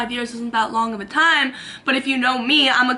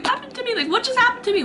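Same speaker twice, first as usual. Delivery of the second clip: bewildered panic; 11 semitones higher, much faster than usual. Retold or imitated. Freaking out after release.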